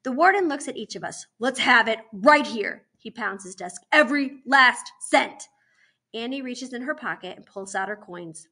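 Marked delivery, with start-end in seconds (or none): 1.4-2.9 loud, slow command; forceful angry authority; stern demand. Acted. Warden demands the money. 3.9-5.5 loud, slow, word-by-word order; angry emphatic command; stern demand. Acted. Warden pounds desk, demanding money.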